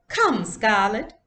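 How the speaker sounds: high, slow, grand doll voice; lightly voiced fine lady doll. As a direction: play the lady doll with airs. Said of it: "using her doll voice".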